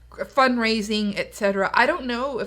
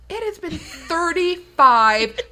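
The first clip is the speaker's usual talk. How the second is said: mock exasperation; 3 semitones higher, 6 dB louder, slower than usual. Acted incredulous comeback to relatives.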